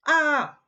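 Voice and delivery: short, high, loud playful yelp; a pretend cry of alarm; mock scream. Teacher recoils from the wordy slide, mock scream.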